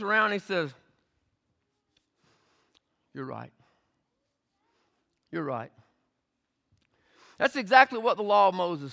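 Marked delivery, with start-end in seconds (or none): 3.1-5.7 soft and low, calmly agreeing; quiet calm Jesus. Acted. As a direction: disarm them gently. Jesus answering the Pharisees quietly.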